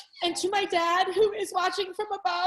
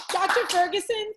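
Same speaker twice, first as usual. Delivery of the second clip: normal talking voice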